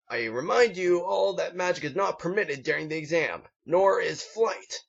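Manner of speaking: high, commanding instructor voice; stern drill instructor. Acted. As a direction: bark out the rules to the recruits. Instructor warning recruits.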